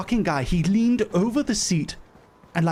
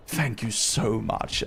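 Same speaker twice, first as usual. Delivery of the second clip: slow, lower, gushing thanks; thick, pointed gratitude; 6 semitones lower, 4 dB quieter, slower than usual. Retold or imitated. Passenger thanks the steward so the man hears, laid on thick. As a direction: rub it in with every word. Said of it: "really rubbing it on thick".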